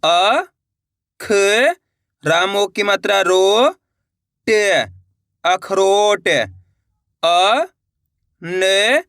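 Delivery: slow, careful syllable dictation; slow spelling. Shown as a demonstration. Teacher sounding out a word for students, slow and careful. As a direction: sound out each syllable slowly, then blend. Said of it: "इसके अंदर पहला शब्द है".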